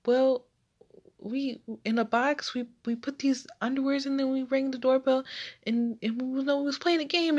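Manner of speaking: put-on a nervous kid explaining himself in a rush; flustered kid. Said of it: "so flustered".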